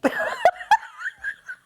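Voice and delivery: shrieking giggle; squealing laugh; high squealing laugh. Real, not acted. Laughing at the twin toy.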